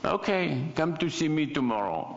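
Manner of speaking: put-on French accent. French gentleman inviting a designer over, mock French accent. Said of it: "in this really thick French accent".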